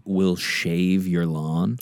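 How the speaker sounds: slow, savoured punchline; drawn-out slogan delivery; slow slogan read. Shown as a demonstration. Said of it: "our slogan underneath the logo".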